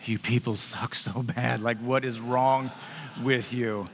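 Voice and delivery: imagine a fed-up teacher despairing under their breath; hammed-up condescending disbelief; mock annoyed. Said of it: "He's so annoyed right here".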